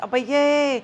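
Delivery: lightly amazed gasp of a phrase; surprised exclamation. Retold or imitated. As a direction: react as if you just spotted something. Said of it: "you're surprised".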